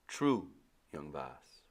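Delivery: put-on slow, low island-accented reply; old Caribbean sailor. Old sailor brushing off the boy, calm Caribbean drawl. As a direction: dismiss the boy calmly. Timothy speaks.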